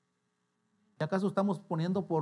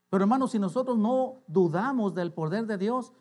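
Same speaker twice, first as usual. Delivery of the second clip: raised, higher preacher's voice; forceful preaching; 6 semitones higher, 7 dB louder than usual. Real, not acted. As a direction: preach it out loud to the congregation.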